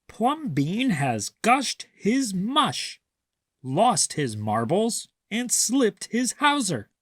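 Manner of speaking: slow, high mocking voice; gossiping neighbors. A narrator's reading. Neighbors mocking Plumbean, high and drawn out. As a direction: drag out the gossip, scandalized. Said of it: "the people said".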